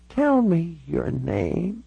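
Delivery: put-on high, very slow elderly voice; frail old lady dwelling on each word; very old woman. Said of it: "She looked all of ninety-six years".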